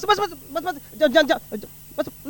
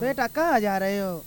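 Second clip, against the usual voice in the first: lightly voiced mother, a bit lower and softer; mother answering her son, a touch lower; mother's voice; 5 semitones lower, 11 dB quieter than usual. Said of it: "ओ री अम्मा".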